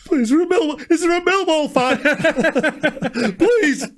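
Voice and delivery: high desperate begging with laughs; pleading. Pretend. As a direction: beg for help, laughing in panic. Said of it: "I'd be like".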